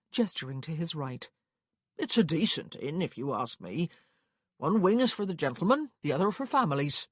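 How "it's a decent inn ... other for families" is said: louder country clerk voice, slightly raised; easygoing clerk, chatty and raised; clerk recommending an inn. Acted. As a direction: play a local giving a homely recommendation.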